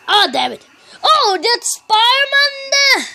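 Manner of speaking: usual speaking voice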